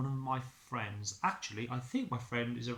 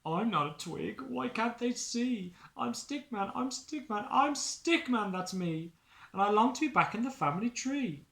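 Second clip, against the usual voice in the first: high, loud pleading protest; desperate Stickman; 8 semitones higher, 4 dB louder than usual. Acted. Stickman pleads with the dog, high and upset. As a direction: cry out to be recognised.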